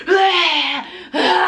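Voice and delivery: high pained moaning cry; labor groan. Retold or imitated. Laboring mom on all fours, pained groan. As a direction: groan through the contraction.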